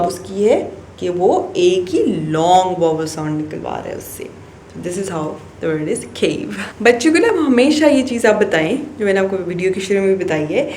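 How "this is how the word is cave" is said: very slow, each word laid out; slow summing up. Shown as a demonstration. Teacher wraps up the word cave for parents, very slowly. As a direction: spell out the conclusion slowly.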